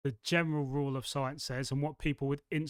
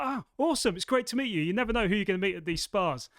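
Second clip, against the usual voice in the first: imitating excited stranger; 5 semitones higher, 4 dB louder than usual. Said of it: "the guy was just like".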